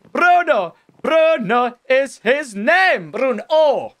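high, loud Bruno in a put-on accent; exaggerated accent. Gamer proclaiming the dog's new name, exaggerated accent. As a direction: draw the name out in a mock accent. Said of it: "like, an accent now".

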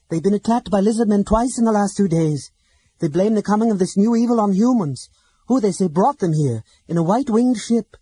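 loud, high, angry Silvara; angry report. A narrator's reading. Silvara reports the refusal to the companions, angrily. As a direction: spit out the bad news, furious. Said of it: "Silvara's was flushed with anger".

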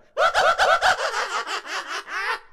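long, high cackle; high-pitched mocking laughter; gloating cackle. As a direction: cackle high at your own jibe. Laughs at own mocking joke.

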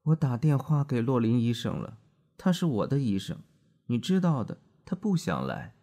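groggy man apologizing, voice lifted; a lightly voiced drunk husband coming round; dazed husband. A narrator's reading. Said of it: "他说".

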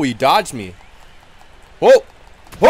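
usual speaking voice

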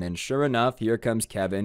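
ordinary delivery

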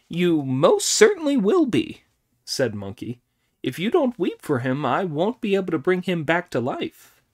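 quick, cocky monkey king boasting. A narrator's reading. Monkey voiced in story.

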